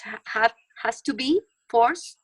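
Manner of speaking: ordinary talk, nothing marked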